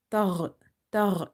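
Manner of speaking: "tagh" ending in a heavy gh from the throat; upper-throat ghain. Shown as a demonstration. Teacher showing ghain's place, heavy throaty "tagh". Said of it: "Ghain is from the upper throat".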